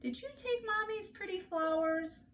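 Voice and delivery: high, slow coaxing mommy voice; sweet baby talk. Acted. Mother coaxes toddler Fudge about the flowers, high sweet baby talk. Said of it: "my mother asked him".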